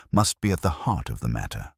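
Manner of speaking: usual speaking voice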